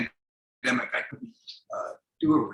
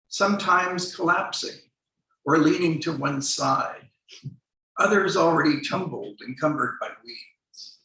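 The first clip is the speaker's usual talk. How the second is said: measured, deliberate poetry reading voice; poem reading; 2 semitones higher than usual. Acted. Poet reading his memoir poem to the audience, measured reading.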